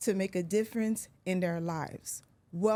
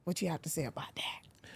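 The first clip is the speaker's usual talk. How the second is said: softer whispered challenge; 4 semitones lower, 9 dB quieter than usual. Real, not acted. Host whispering a teasing question to her guest.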